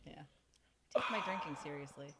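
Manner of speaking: usual speaking voice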